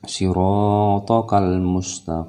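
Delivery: melodic Quran recitation. Shown as a demonstration. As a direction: recite it in chant. Recited verse.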